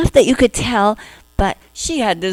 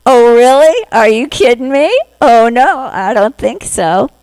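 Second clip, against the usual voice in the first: loud, higher mock disbelief, half-hearted; doubtful disbelief; 4 semitones higher, 5 dB louder than usual. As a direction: scoff like a sceptic who won't buy it. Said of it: "Lady Sunrise is doubtful here".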